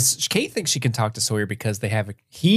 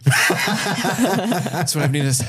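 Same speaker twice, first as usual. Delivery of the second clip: slow, high laughing exclamation; laughing relief; 6 semitones higher, much slower than usual. Pretend. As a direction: gasp it out with a laugh. Acts out Jack finding inhalers.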